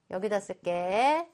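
ordinary talk, nothing marked

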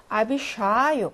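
아비샤요 with a long, stressed 샤, clear "a"; stressed A sound. Shown as a demonstration. Teacher models a stressed vowel for students, 샤 in 아비샤요 drawn out. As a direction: slowly stretch the 샤 in 아비샤요. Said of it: "강세가 있으니까 아 발음 납니다".

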